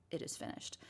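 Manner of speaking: hushed, reverent whisper. Acted. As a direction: breathe the words out softly. Solemn quote of Jesus' last words.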